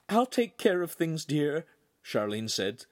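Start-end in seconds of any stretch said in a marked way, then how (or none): 0.1-1.6 higher, tearful motherly voice; a touch of sniffling mother. Acted. Charlene seeing her son off, mildly tearful. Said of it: "starting to sniffle again".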